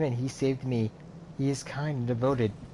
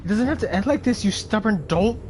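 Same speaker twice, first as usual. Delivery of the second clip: pleading cry; 8 semitones higher, 3 dB louder than usual. Acted. Pleading with old friend Bolverk.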